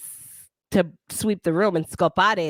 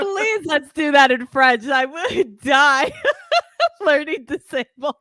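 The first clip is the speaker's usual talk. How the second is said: laughing through the words, drawn out; 8 semitones higher, 5 dB louder, slower than usual. Real, not acted. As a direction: giggle helplessly as you beg. Laughing at the French idea.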